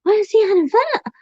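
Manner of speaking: sudden high shocked cry. Retold or imitated. Retelling learning her friend is on a ventilator, shocked. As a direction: gasp it out in disbelief. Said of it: "I'm like".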